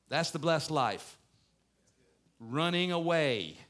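loud, high, driving sermon delivery; full-force preaching. Acted. Pastor rousing the congregation, loud and forceful preaching. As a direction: preach it out to the whole room.

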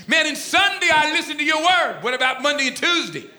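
high mock protest; comic high-voiced complaint; griping disciple. Acted grumbling disciples in retelling.

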